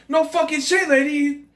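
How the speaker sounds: high, loud imitation of a movie hero yelling; imitated angry outburst, loud and high; exasperated yell. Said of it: "he yells out".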